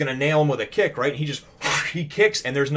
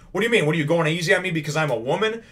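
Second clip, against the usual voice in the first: higher-pitched mock indignation; indignant protest; 6 semitones higher, 4 dB louder, faster than usual. Bested sergeant challenges departing swordsman, mock indignation. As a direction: protest in a raised, offended voice.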